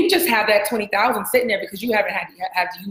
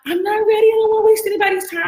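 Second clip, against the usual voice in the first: higher, unsure imitated listener; hesitant inner voice; 12 semitones higher, 4 dB louder than usual. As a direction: voice the nervous listener's doubts. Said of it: "you think".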